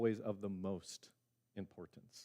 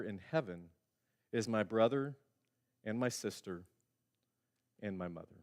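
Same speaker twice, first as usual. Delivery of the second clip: solemn scripture reading. Acted. Preacher reads Jesus' words.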